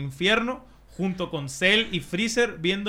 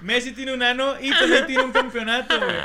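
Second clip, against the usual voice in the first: laughing; 9 semitones higher, 7 dB louder than usual. Real, not acted. Delivers punchline still laughing.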